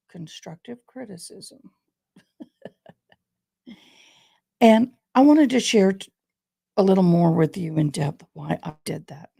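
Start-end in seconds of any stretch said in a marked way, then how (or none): none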